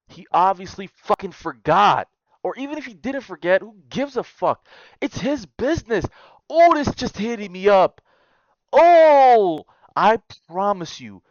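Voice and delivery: high, loud outraged rant; outraged shout. Real, not acted. Ranting at the brother who forgot, high loud outrage. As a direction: build into a yell. Outburst over the post.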